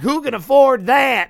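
slow, high, loud mock disbelief; shocked tourist. Tourist shocked by condo prices, loud high disbelief. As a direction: gasp it out like a stunned tourist.

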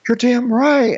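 high-pitched mock indignation; Shep. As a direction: squawk it like a man feeling wronged. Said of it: "And Shep is like".

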